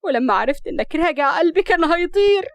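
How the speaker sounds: high, impassioned tearful reproach. A narrator's reading. Rahaf tells Murad how she waited for him, tearfully. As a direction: let the hurt climb through the tears. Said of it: "وكملت بدموع".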